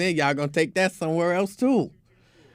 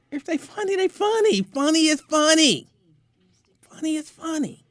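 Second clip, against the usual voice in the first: emphatic; 13 semitones higher, 3 dB louder than usual. Real, not acted. Emphatic high-pitched insistence.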